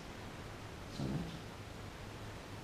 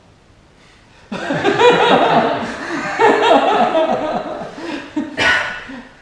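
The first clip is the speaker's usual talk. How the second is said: loud laugh; 16 dB louder than usual. Real, not acted. Laughing at the story.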